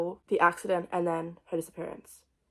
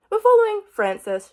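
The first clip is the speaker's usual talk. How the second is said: excited; 12 semitones higher, 11 dB louder than usual. Real, not acted. Launching into the synopsis.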